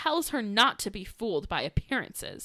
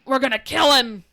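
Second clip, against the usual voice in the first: louder, threatening brothers; mock angry brothers; 4 semitones higher, 11 dB louder than usual. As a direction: growl out the threat in mock fury. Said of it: "Her brothers are like".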